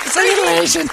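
ordinary delivery

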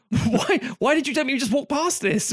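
high, incredulous complaint; indignant gallery visitor. Retold or imitated. Visitor complaining he was told to walk past, high and incredulous. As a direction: demand it in high disbelief. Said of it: "it's like".

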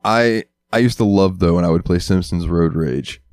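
ordinary delivery

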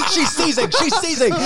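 high squeal through laughter; giggling, voice way up; laughing. Real, not acted. Laughing at friend's fit.

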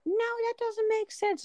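high, slow mock kid whine; imitating daughter. Mom replaying daughter's refusal over zero, high drawn-out mimicry. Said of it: "she's like".

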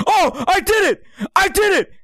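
high, loud imitation of an elated roommate; triumphant shout. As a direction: cry out as if you made a huge discovery. Roommate's triumphant cry in story.

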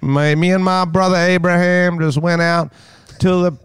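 hammed-up Southern American accent. Shown as a demonstration. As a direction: play a Bible Belt farm boy. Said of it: "down south to the Bible Belt".

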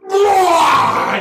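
loud, high imitated retch; monster vomiting noise. Monster throws up on the hero, loud retching noise. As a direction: heave out a big mock puke. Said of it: "It throws up three dead kids".

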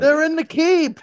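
high, loud, drawn-out yell; mock battle cry. Girls warning of attackers during the fight, mock battle cry. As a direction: holler the alarm like a castle defender.

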